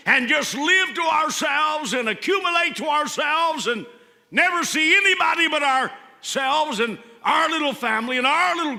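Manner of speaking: fervent sermon climax; preaching at full force. Real, not acted. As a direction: build it like a revival sermon.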